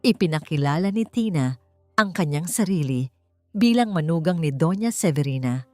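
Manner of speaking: usual speaking voice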